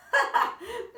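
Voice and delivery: loud high laugh; chuckle. Acted. Mister Stink amused by the girl's question, laughing. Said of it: "Mister Stink chuckled".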